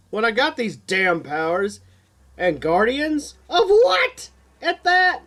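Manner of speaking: rising, frustrated outburst; exasperated disbelief building to a cry; overwhelmed young man exclaiming; exclaiming in frustration. A narrator's reading. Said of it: "Exclaims Keith".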